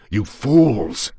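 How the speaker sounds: loud, high cry of fury; furious high-pitched outburst; enraged scream. A narrator's reading. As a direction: scream it at those holding you back. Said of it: "I screamed".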